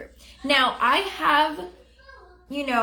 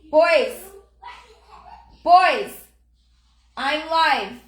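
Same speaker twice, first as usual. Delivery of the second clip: calling out; 5 semitones higher, 8 dB louder, much slower than usual. Real, not acted. Mom shouting at her sons to stop while live, real loud call. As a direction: shout them down, then clip it short.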